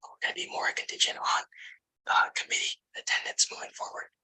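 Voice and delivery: steady unvoiced whisper into the mic. Real, not acted. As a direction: whisper the whole report, voice gone. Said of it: "Sorry, I have to whisper".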